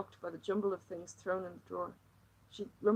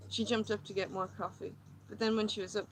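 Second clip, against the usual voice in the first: ordinary delivery, nothing marked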